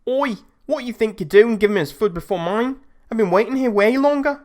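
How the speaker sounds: put-on loud, high angry yell in a Scouse accent; Scouse shout. Angry customer shouting at the McDonald's counter, loud Scouse yell. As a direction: bellow it like an irate Liverpool customer. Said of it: "shouts in a thick Scouse accent".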